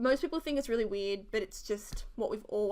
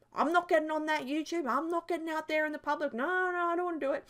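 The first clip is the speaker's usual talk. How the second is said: higher, protesting mock-mum voice; imitating mum's refusal; 5 semitones higher than usual. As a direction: play a mum firmly refusing. Retells mum refusing to appear.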